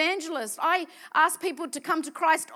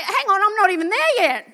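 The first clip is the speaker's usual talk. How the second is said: startled; 6 semitones higher, 11 dB louder than usual. Retold or imitated. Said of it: "I'm like".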